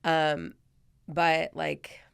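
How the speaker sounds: shaky whisper near tears; holding back tears. Real, not acted. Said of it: "I'm trying not to cry".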